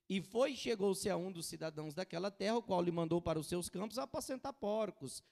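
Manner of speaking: loud, declaimed Bible verse; ringing pulpit reading; forceful scripture reading. Acted. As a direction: proclaim the passage to the congregation.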